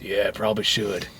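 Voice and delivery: low, soft man's reply; Joe. Retold or imitated. Answering the girl about the money, low soft Joe.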